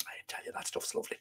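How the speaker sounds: hushed, quick aside; savouring it under the breath; confiding whisper. Real, not acted. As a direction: whisper it like a secret tip. Confiding about his son's liqueur.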